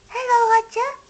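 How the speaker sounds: high, loud call across the bank. A narrator's reading. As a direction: shout a greeting to Otter. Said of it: "shouted Little Hedgehog".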